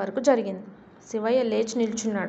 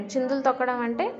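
usual speaking voice

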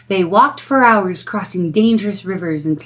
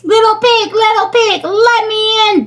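bellowing wolf; 12 semitones higher, 3 dB louder than usual. Acted. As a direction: draw out the wolf's demand. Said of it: "bellowed the wolf".

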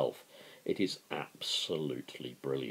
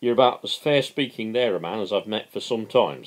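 a wink in the voice, teasing louder; sly mocking; 6 semitones higher, 9 dB louder than usual. Workmate Harlow teasing Owen before the others, sly and mocking. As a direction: needle Owen with a knowing grin. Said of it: "said Harlow, winking at the others".

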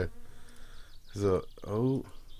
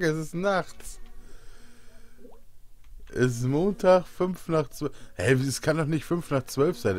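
panicked; 8 semitones higher, 5 dB louder than usual. Pretend. Hungover confusion, panicked rant.

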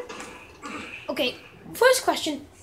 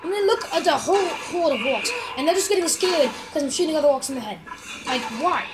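ordinary talk

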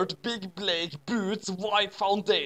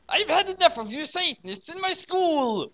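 furious shout; 12 semitones higher than usual. Acted. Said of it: "shouted Dumbledore furiously".

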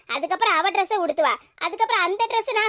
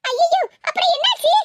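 alarmed cry; 10 semitones higher than usual. Acted. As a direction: gasp at the bad news. Hearing uncle's friend came.

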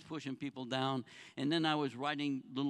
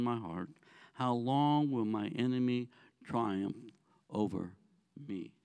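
scripture reading; 7 semitones lower than usual. Acted. Pastor reading Psalm to the congregation, solemn reading voice. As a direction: read the psalm out to the congregation slowly.

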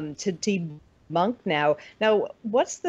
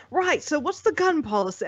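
higher-pitched mock British accent; butchered British accent; 8 semitones higher than usual. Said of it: "I'm butchering a British accent".

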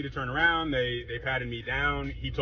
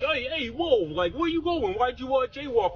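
high, hammed-up Italian-American cop voice; mock Italian cops; 12 semitones higher, slower than usual. Cop stopping the jaywalker, in a much higher mock Italian accent. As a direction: play a pushy Italian cop hollering across the street. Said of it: "some Italian guys are like".

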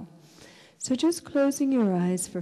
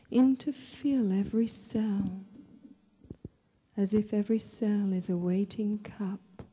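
guided meditation; 4 dB quieter than usual. Real, not acted. Leads a breathing meditation.

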